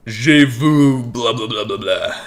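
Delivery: slow, loud mock French gibberish; hammed-up French babble, then trailing off. Mocking his forgotten French class with loud slow babble. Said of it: "That's all I remember".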